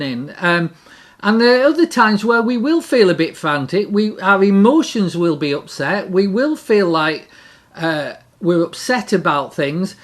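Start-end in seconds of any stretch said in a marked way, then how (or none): none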